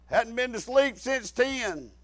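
ordinary talk, nothing marked

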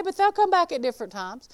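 ordinary talk, nothing marked

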